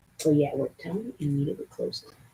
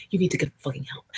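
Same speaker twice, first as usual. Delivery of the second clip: ordinary delivery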